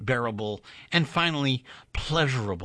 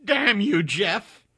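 high, louder cry of hurt anger; angry outburst; 9 semitones higher, 5 dB louder, slower than usual. A narrator's reading. Doris curses Jeff after his cold reply, higher and louder. Jilted lover curses him.

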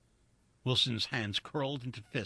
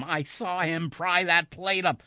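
higher, louder, slow protest; urgent insistence; 7 semitones higher, 6 dB louder than usual. A narrator's reading. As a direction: insist urgently before being dismissed. Insists on what he saw.

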